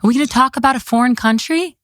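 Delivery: put-on high, spelled out as if to small children; talking down. Commissioner telling politicians what she'll say, talking down. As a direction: lecture them like five-year-olds. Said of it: "talking to these politicians like they're five-year-olds".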